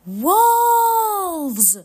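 high, loud drawn-out cry of alarm. A narrator's reading. Bill warning Henry of wolves, alarmed. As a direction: call out the danger, pitched well up.

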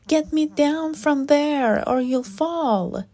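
acted motherly scolding, pitched high; alarmed mother. A narrator's reading. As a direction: call up sharply to a climber. Mother scolds climbing child.

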